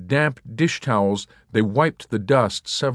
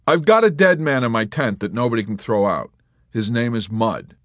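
louder flat complaint; Yossarian; 4 semitones higher, 5 dB louder than usual. A narrator's reading. Yossarian telling of the dead man, grim. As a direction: state it grimly.

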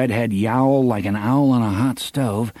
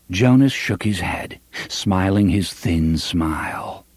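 ordinary delivery

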